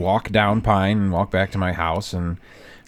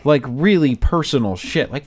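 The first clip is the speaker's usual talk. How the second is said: normal talking voice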